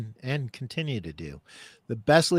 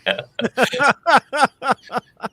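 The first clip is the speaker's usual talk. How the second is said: long high burst of real laughter; loud laugh; 12 semitones higher, 8 dB louder than usual. Real, not acted. Laughing at his own story of the breakdown, a real high laugh. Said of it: "I'm sorry I'm laughing".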